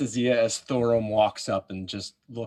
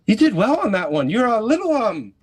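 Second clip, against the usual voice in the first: high, louder, quick in-character praise; chirpy elf; 12 semitones higher, 7 dB louder than usual. Acted. Elf to fighter after the goblin kill, high chirpy praise. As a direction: pipe up high as the elf, then falter.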